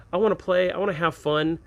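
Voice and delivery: ordinary delivery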